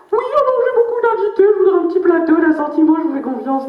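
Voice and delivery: voix de bourgeoise aiguë et maniérée; bourgeoise imitée. Retold or imitated. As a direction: jouer la cliente chichiteuse, très haut perché. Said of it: "elle arrive chez le marailleur". (bourgeoise qui commande au poissonnier, voix haut perchée)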